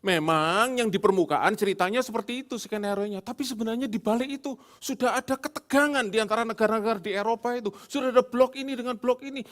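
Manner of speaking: high, loud interrupting wife voice; hammed-up wife correcting her husband, high and loud. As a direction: cut in like a know-it-all wife.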